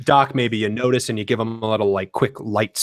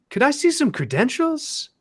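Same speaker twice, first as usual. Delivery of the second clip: receptionist voice; 13 semitones higher than usual. Acted. Receptionist asks for ID.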